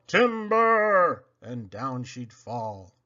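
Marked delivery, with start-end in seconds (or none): 0.1-1.2 long, loud call of a falling tree; lumberjack yell. Acted. Paul Bunyan hollers as his tree falls, loud drawn-out yell. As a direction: holler it across the woods. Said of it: "Then he'd yell".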